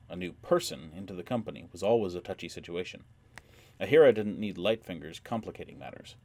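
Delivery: plain reading voice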